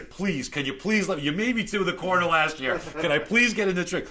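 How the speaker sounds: high, loud mock pleading; retold begging plea. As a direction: beg the magician to be let in. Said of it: "I'm negotiating with David Copperfield".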